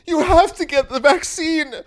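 hammed-up hysterical grandma plea; hysterical woke. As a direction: wail it with a fake sob and laugh. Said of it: "the wokes go".

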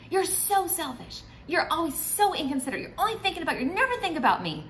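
high, whiny accusation that speeds up; blaming wife. Retold or imitated. Wife making blanket accusations at husband, high complaining tone. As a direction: pile on the blame. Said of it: "That's like making a blanket statement".

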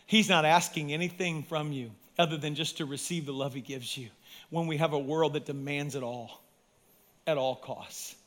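usual speaking voice

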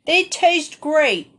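smacking lips. Acted. Dan defying his cousin while chewing berries, smacking. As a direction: savour it out loud to spite her.

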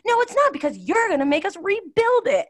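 high, loud whiny complaint; mock angry husband. Husband refusing to rebuild, loud high mock complaint. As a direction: whine it as a fed-up husband. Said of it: "And he's like".

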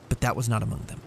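usual speaking voice

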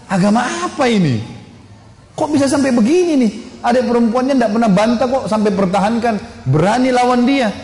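high-pitched, baffled disbelief; astonished. Acted. Umar marvels at his defiant sister, astonished. Umar berpikir heran, terkejut.

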